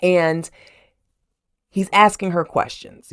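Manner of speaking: ordinary delivery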